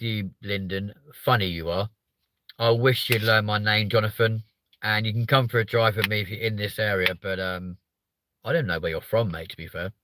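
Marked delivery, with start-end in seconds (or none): none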